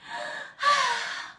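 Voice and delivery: high theatrical gasp; mock dramatic gasp. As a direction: gasp like a swooning wife. Mocking breathless gasp.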